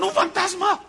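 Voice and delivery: high, startled cry of fear; frightened cry. Retold or imitated. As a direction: shriek it as scared disciples. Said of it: "se asustaron y dijeron".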